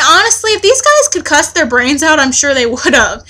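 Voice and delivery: usual speaking voice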